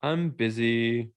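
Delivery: slow, lazy mock excuse; mock busy excuse. Mocking the too-busy person, slow lazy whine. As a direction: drag it out like a lame excuse.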